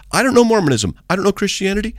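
high, disbelieving echo of an accusation; retold incredulity. Preacher challenging a heckler's claim, retold incredulity. Throws accusation back at the driver.

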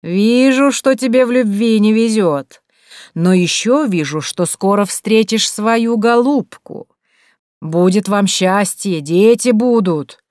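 high, loud, slow mystic pronouncement; prophesy like a street fortune teller. A narrator's reading. Maria posing as a fortune teller to the baron, slow high prophecy.

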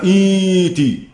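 "إيتي" very slowly, the long i held; pronunciation demo. Shown as a demonstration. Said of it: "تبقى". (نطق "إيتي" بمد الياء ببطء شديد)

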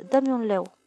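usual speaking voice

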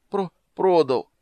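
slow, high, stammering moan; groaned confession. A narrator's reading. Doppler confesses to the angry horse owner, moaning. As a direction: groan it out haltingly, loud and high. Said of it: "простонал".

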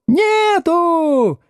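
very high, drawn-out jubilant howl; high-pitched triumphant schoolkid cry; gleeful yell. Acted. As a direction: crow it from the back row. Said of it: "ликующе завопила галёрка".

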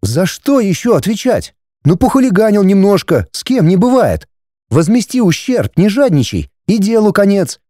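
high-pitched wife waving trouble away; dismissive wife. Acted. Wife excusing her son to husband, dismissive. Wife's figure in dialogue.

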